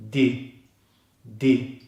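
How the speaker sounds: "Di" as a short syllable with the I of "sit"; D plus short I. Shown as a demonstration. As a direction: cut "Di" short, tongue forward. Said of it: "The D follows into the short single I vowel".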